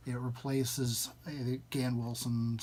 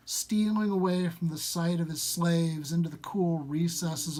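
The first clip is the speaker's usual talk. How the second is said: measured, slightly raised storytelling read; story narration; 3 semitones higher, 3 dB louder than usual. Acted. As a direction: read it like an old legend by the fire. Reads Lovecraft story aloud.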